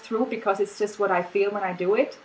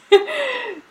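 burst of laughter; 4 dB louder than usual. Real, not acted. Laughs at seven lives.

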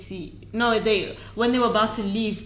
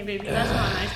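usual speaking voice

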